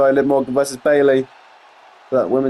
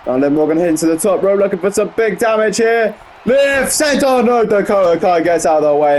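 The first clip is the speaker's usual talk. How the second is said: excited play-by-play; 6 semitones higher than usual. Real, not acted. Wrestling commentator calling a top-rope miss, excited play-by-play. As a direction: pitch climbing to a high peak.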